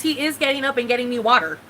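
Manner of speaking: ordinary talk, nothing marked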